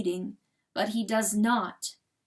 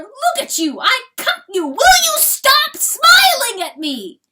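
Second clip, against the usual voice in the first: high screaming, building higher; frantic cry; 14 dB louder than usual. A narrator's reading. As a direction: scream in exasperated torment. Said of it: "cries Chanticleer".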